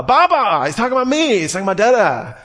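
high-pitched, hammed-up proud delight; thrilled parent. Father hears baby say his name, mock thrilled outburst. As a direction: burst out high as if the baby just named you.

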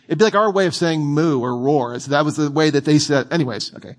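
ordinary delivery

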